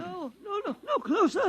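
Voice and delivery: high, panicked pleading; backing away in terror. Acted. Priest holding gun on heretic, panicked high cry.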